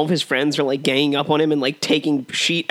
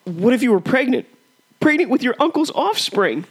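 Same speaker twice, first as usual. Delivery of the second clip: much higher, hammed-up sneering voice; shrieking villain quote; 11 semitones higher than usual. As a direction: squeal the taunt like a crazed villain. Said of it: "a good old-fashioned quote war".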